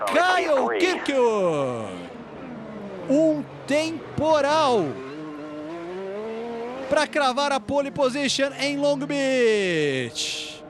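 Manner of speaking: slow, drawn-out triumphant call. Acted. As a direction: savor the pole announcement. Calling the pole winner.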